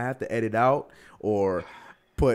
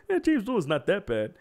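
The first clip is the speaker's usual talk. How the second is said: dismissive mimic; 9 semitones higher than usual. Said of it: "that's what I hear".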